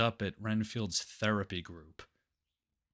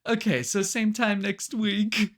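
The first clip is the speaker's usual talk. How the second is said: higher, louder and slowed wrap-up; mock therapist sign-off; 10 semitones higher, 6 dB louder, slower than usual. Therapist closing the revived group's session, mock sign-off. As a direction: lightly act a group leader signing off.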